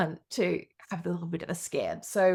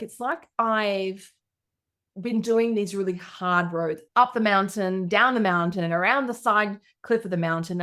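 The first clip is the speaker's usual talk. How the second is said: ordinary delivery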